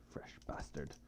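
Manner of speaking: hushed, breathy hiss of an insult; low whispered line read from the game; whispered curse. Acted. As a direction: whisper it like a sneer under breath. Reading dialogue, whispered curse.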